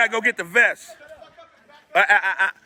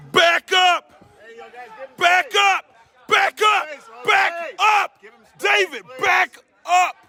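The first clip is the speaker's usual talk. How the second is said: shouting; 10 semitones higher, slower than usual. Real, not acted. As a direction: shout the crowd back, real alarm. Yelling at crowd, armed man.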